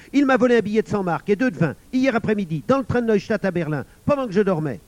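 put-on loud, high, urgent boy accusing; high, insistent outburst; crying out. A narrator's reading. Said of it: "s'écria Émile en bondissant".

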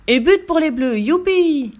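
high, loud goal celebration; excited cheer. A narrator's reading. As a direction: cheer the goal with a big hurray. Goal scored in a match.

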